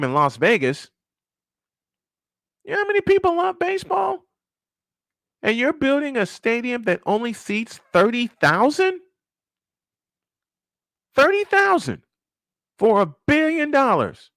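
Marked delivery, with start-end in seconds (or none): 2.6-4.2 high, fast shout of disbelief; incredulous outburst. Real, not acted. Incredulous rant about small stadium. 5.4-14.3 incredulous rant. Real, not acted. Incredulous rant about stadium cost.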